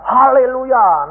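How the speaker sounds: slow, high, loud mock haleluya; mock hallelujah. Mocking a churchgoer's habitual drawn Haleluya. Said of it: "Bapak ini biasa".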